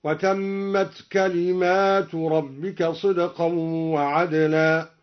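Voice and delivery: كلمات read with a long alif after the mim; slow recitation stretching كلمات; plural reading. Shown as a demonstration. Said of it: "بألف بعد الميم على الجمع هكذا".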